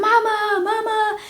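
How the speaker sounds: put-on high, wailing child's cry for mama; mock-wailing little kid calling mama; retold child's scream for mom. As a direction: scream for mama like a panicked toddler. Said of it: "there I am screaming".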